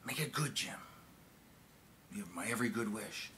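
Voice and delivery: soft, measured send-off; president's confiding quote. Retold or imitated. Retelling the president's good wishes, softly. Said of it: "He said".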